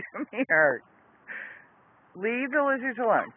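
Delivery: usual speaking voice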